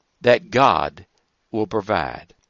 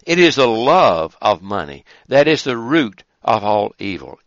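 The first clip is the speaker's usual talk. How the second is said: normal talking voice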